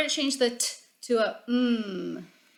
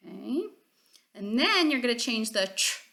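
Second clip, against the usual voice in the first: ordinary delivery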